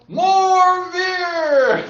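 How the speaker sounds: high, long-held crowd yell; imitating crowd's yell. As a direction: echo a rowdy crowd, high and stretched out. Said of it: "everybody would yell".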